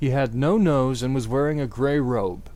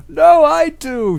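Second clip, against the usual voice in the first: high, loud, slow angry shout; hammed-up furious shout; 14 semitones higher, 12 dB louder, slower than usual. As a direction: shout back at him.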